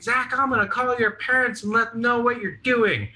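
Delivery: high-pitched scolding mom; mock angry mom. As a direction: threaten him like an outraged mother. Mom threatening to call parents.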